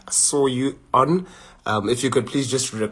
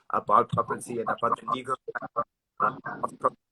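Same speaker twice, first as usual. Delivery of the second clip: bad connection, voice cutting out; breaking up; faster than usual. Real, not acted. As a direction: talk through a choppy signal. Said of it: "your signal is cutting a little bit".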